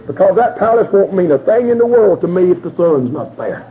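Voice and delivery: ordinary delivery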